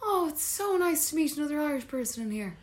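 high, soft, gushing imitation; friendly stranger. Stranger greets a fellow Irish person, high and gushing. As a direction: gush sweetly like an oblivious stranger.

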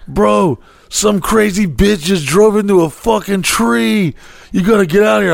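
lightly voiced neighbor raising the alarm; hinted neighbor. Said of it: "he's like".

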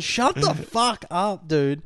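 high, drawn-out mock rebuke; imitated exasperated telling-off. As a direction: stretch it out like a fed-up teammate. Imitating teammate telling off David.

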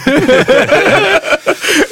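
high, helpless laugh; real laughter. Real, not acted. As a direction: burst out laughing at your own story. Laughs at his own story.

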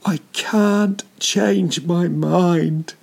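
put-on high, slow, wailing child voice, well above his own; imitating crying child. Father retelling how his son sobbed at the gate, high and slow. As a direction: mimic his little son sobbing out the words. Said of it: "And he was crying".

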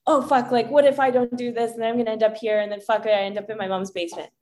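fast, rattled worrying; imitating a panicking inner voice; mock anxious spiral. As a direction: rush through the catastrophizing thoughts. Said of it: "Not, wherever your loop takes you".

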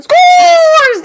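big high shout of scores; excited goal call. Acted. Fan shouting at the screen when the goal goes in, excited shout. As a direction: yell it like a goal horn just went off.

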